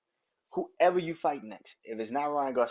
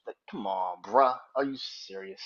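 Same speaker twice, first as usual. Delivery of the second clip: ordinary talk, nothing marked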